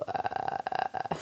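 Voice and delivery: unvoiced creepy throat croak; soft ghostly croak; Kayako croak. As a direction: do the Grudge ghost's death rattle. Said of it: "where she does her whole".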